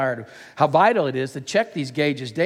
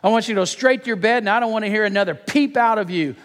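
high, emphatic parental order; scolding; 6 semitones higher than usual. Acted. Dad sending daughter straight to bed, emphatic scolding. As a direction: lay down the law like dad. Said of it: "I scolded".

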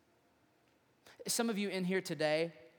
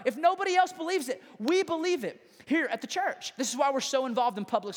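raised, high, urgent preaching voice; impassioned preaching; 6 semitones higher, 5 dB louder than usual. Real, not acted. Preacher urging the church that everyone matters to God, impassioned preaching. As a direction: drive each phrase home to the congregation.

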